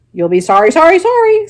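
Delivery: very high, loud goose warning; the goose warns Wilbur, high and loud; scolding goose. Acted. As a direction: squawk the warning. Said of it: "said the goose".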